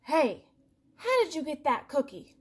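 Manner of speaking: loud, demanding cartoon pigeon; a jealous bird barking a question; indignant pigeon shout. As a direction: call out at the duckling, affronted. Pigeon demands answer from duckling.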